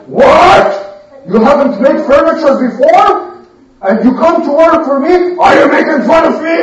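loud, high outraged what; shocked angry boss; indignant carpenter. Acted. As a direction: scold them for wasting his time.

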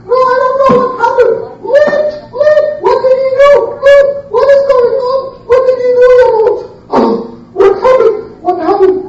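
very high squealing voice; hammed-up panic. Blinded men asking each other, high comic panic. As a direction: babble in mock alarm, high-pitched.

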